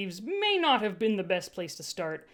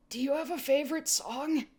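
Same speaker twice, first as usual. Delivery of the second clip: hoarse; 6 semitones higher than usual. A narrator's reading. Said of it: "I asked hoarsely".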